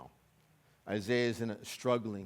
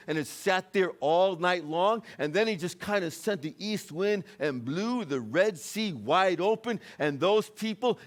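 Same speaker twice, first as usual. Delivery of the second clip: building, raised-pitch sermon delivery; rousing pulpit storytelling; fervent preaching; 6 semitones higher, 6 dB louder than usual. Acted. Preaches the Red Sea story at full force.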